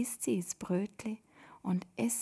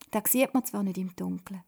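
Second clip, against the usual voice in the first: ordinary delivery, nothing marked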